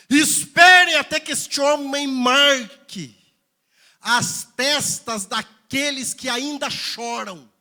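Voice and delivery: high, loud, forceful preaching. Real, not acted. Pastor telling of the voice that halts the swordsmen, forceful preaching. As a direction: proclaim it at full force, drawing out the commands.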